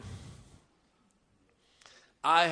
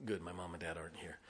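quiet joking aside; 9 semitones lower, 15 dB quieter, faster than usual. Real, not acted.

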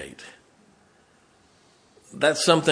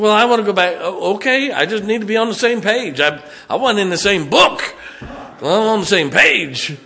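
raised, higher-pitched exasperated retort; hammed-up frustration, louder and higher; mock exasperation; 7 semitones higher, 4 dB louder than usual. Husband retelling a spat with his wife, exasperated.

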